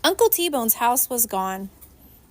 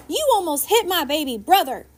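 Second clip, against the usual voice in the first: louder, higher protest for her brother; angry holler; 6 semitones higher, 3 dB louder than usual. A narrator's reading. Girl scolding the woman who threw the can, angry. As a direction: scold her for the near miss. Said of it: "and hollered".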